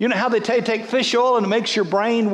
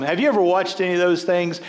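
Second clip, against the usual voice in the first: ordinary delivery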